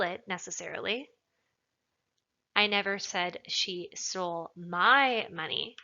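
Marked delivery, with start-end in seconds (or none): 2.5-5.8 slow delivery, "my" set apart and punched; emphatic, drawn-out "my"; stress on MY. Shown as a demonstration. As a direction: lean hard on the word "my". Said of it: "maybe she took somebody else's money".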